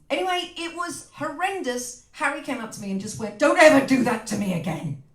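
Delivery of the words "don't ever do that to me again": put-on loud American accent. Husband scolding her after the shop scene, in a loud American accent. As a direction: declare it in a big American accent. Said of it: "But with an American accent".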